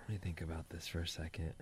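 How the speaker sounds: whisper. Real, not acted. Mutters to himself while thinking.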